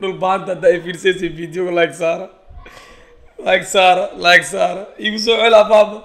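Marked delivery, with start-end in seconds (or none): none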